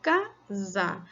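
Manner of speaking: "casa" in two separated beats; syllable by syllable. Shown as a demonstration. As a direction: gap between ca and sa in "casa". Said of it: "porque falamos casa".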